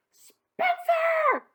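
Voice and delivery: high, loud scream of his name; mother screaming at the messy pile. Acted. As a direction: shriek his name in outrage. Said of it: "she screamed".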